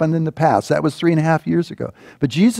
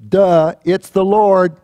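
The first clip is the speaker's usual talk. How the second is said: louder, slow, hammed-up dopey realization; dopey fisherman; 5 semitones higher, 5 dB louder, slower than usual. Fisherman on the boat stating the obvious, mock dopey. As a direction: play it dumb and obvious. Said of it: "one of the guys on the boat goes".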